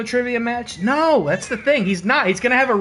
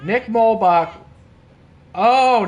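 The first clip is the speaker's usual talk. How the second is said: ordinary delivery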